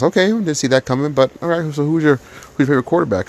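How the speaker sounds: pitched well up, imitating the surprised coach; lightly voiced coach, caught off guard. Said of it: "He goes".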